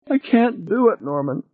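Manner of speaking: high, tearful wail trailing off; crying. A narrator's reading. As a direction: weep out the refusal. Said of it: "She was crying over the intercom".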